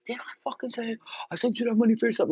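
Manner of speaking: mild mock yell at his sister; a hint of an angry brother yelling; retold yell. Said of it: "he yelled at me".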